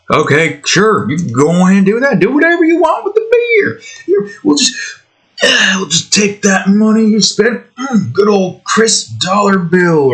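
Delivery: high squeaky put-on voice climbing sharply; gleeful seller. Beer seller taking the angry buyer's money, mock cheery indulgence. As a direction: play a seller happily humouring the customer, way up high.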